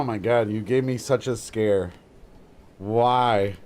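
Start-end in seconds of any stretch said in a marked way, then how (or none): none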